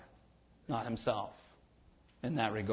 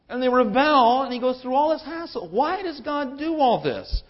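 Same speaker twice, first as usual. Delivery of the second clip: rising, louder impassioned questions; exasperated asking; 7 semitones higher, 5 dB louder than usual. Retold or imitated. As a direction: press each question harder. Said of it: "because we say".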